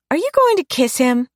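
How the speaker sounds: put-on high, cheeky child voice; a young girl teasing her mum; little girl voice. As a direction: daughter teasing her mother about a kiss, high child voice. Young daughter Mary teasing.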